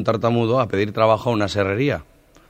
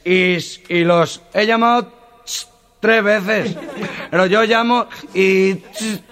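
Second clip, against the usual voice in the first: much higher and louder, very slow, stammering; stutterer; 10 semitones higher, 4 dB louder, slower than usual. Retold or imitated. Stutterer explaining his call to the firemen, stammering. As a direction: strain to get the call out. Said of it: "el tartamudo".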